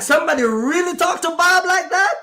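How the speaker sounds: high, incredulous question rising at the end; mock disbelief. Warehouse guys amazed someone stood up to Bob, incredulous. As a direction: imitate astonished onlookers. Acts astonished warehouse workers.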